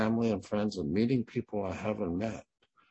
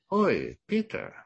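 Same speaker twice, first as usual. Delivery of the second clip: mother calling out; 10 semitones higher, 5 dB louder than usual. Retold or imitated. Mom calls her son again.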